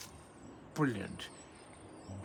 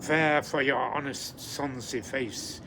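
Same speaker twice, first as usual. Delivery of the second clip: louder, grand opening line of a Scots poem; poem recitation; 3 semitones higher, 7 dB louder than usual. Acted. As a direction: declaim it to the haggis with pride. Recites Address to a Haggis.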